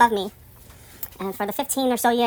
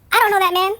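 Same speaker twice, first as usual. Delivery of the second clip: higher, louder insistence; indignant denial; 10 semitones higher, 5 dB louder than usual. Real, not acted. Denying the building rumour, loud indignant insistence. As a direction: stamp down the rumour.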